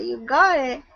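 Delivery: ordinary talk, nothing marked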